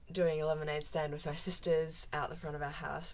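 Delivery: normal talking voice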